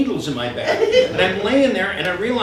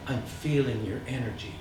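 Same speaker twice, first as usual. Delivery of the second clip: low soft new-age murmur; lightly voiced healer feeling your energy; a hint of a dreamy healer; hushed mystical healer; 9 semitones lower, 14 dB quieter than usual.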